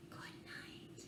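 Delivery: very soft goodnight whisper. Acted. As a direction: breathe goodnight over a sleeping child. Closing line of bedtime book.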